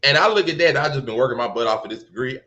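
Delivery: ordinary talk, nothing marked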